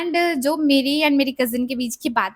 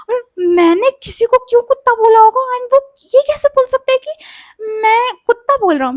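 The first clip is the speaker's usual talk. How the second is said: higher, louder baffled outrage; outraged and baffled, pitched up; indignant disbelief; 10 semitones higher, 7 dB louder than usual. Retold or imitated. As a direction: protest in shocked confusion. Indignant retelling of confusion.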